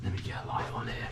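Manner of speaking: breathy unvoiced whisper. Real, not acted. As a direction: mutter it under the breath. Repeats the line whispered.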